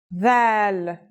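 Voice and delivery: Dal starting with TH as in "this"; Dal with its D softened to a voiced TH. Shown as a demonstration. Teacher showing the isolated version, Dal with voiced TH. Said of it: "like the T H sound in the word this".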